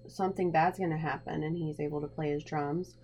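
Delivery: ordinary talk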